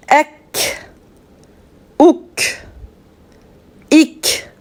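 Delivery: "Ek", "Ouk", "Ik" avec un K fin et net; K après voyelle. Shown as a demonstration. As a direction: finir chaque syllabe sur un K léger. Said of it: "nous allons entendre le son". (K arabe léger final)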